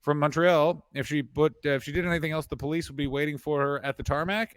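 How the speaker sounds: ordinary talk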